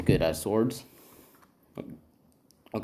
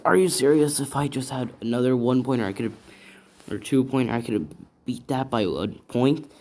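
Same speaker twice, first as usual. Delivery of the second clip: raised, higher-pitched indignant outburst; pissed-off complaint; 4 semitones higher, 8 dB louder than usual. Acted. Deku griping about losing by one point, acted pissed off. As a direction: gripe loudly, acting out his frustration. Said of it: "He's kinda all pissed about it".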